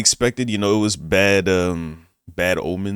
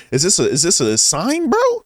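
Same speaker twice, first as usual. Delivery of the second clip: incredulous; 9 semitones higher, 4 dB louder than usual. Retold or imitated. Incredulous at a bad omen.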